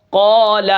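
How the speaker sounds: قال with a full, heavy ق; teacher modelling a thick قال; heavy recitation. Shown as a demonstration. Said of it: "جیسے قاف الف زبر قا".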